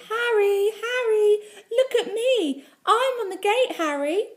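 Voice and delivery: put-on very high, loud child's show-off yell; shouted. A narrator's reading. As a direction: squeal it out to a friend down the road. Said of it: "My little sister shouted".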